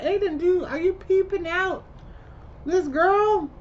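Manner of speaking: high, exasperated "dude, really?"; incredulous scolding. Retold or imitated. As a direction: talk to the screen like he's lost it. Viewer scolding a film character.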